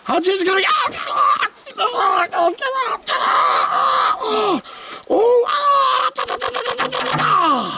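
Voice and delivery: high, hammed-up shrieking at a tiny worm; mock terrified screams. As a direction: overact the panic for laughs.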